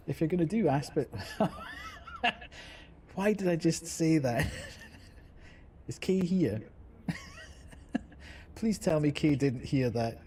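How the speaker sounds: helpless giggles between phrases; embarrassed laughing. Real, not acted. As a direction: talk through real giggles. Embarrassed by own slip.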